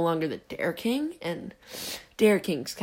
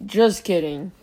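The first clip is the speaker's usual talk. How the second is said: usual speaking voice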